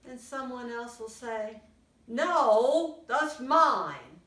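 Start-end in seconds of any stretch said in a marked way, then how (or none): 2.1-4.2 loud, firm refusal; a kid guarding a cookie; possessive no. Acted. Someone refuses to share cookie.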